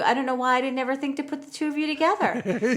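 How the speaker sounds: high, eager put-on therapist voice; mock therapist. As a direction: play the chirpy therapist with a big idea. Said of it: "And she's like".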